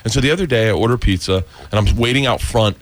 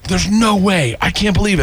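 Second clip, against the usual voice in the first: shocked disbelief; 7 semitones higher than usual. Retold or imitated.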